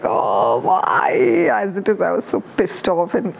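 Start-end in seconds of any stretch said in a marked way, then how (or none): 0.0-1.5 frustrated cry. Retold or imitated. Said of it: "I got so angry".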